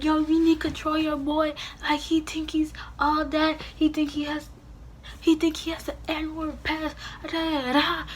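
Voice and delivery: high, heated mock tirade; high indignant rant at his girlfriend; going ballistic. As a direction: confront his girlfriend, all worked up. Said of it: "going, uh, ballistic".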